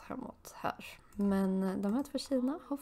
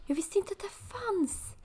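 surprised; 12 semitones higher, slower than usual. Real, not acted. Surprised at a new game item.

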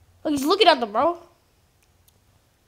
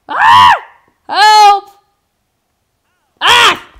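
loud, much higher panicked yells; mock panic; 8 semitones higher, 16 dB louder, much slower than usual. Real, not acted. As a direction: scream for help in play panic. Panicked yelling during play.

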